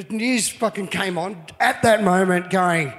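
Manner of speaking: usual speaking voice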